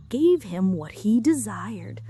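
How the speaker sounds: ordinary delivery, nothing marked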